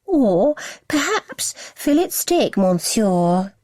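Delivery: slow affected French-posh voice; mock French-posh accent. A narrator's reading. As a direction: ham up a snooty French waiter. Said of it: "adding a French twist".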